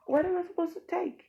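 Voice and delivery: shrill indignant question; outraged shout. Retold or imitated. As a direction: yell it in disbelief. Outraged at the guy's offer.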